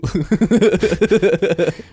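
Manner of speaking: loud, high burst of laughter; laughing. Real, not acted. Co-host cracking up at the comic's slip, high laughter. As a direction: crack up helplessly.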